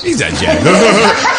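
slow, high, laughing mimicry; laughing echo. Host echoing the president's insult, high laughing mimicry. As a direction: drag it out high through laughter.

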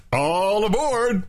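high, drawn-out boarding call; stretched-out high call; conductor's call, off the mark. Shown as a demonstration. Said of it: "that's not how you do it".

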